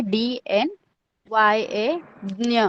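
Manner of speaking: softly name डी, एन, वाई, ए, then ज्ञ; spelled-out pronunciation. Shown as a demonstration. Teacher repeating how ज्ञ is pronounced, spelled out softly. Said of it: "ज्ञ आपको कैसे pronounce करना है".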